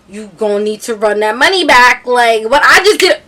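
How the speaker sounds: loud, indignant demand; demanding refund. Retold or imitated. Partygoer demanding her ten dollars back, loud and indignant. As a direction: demand the money back at full volume.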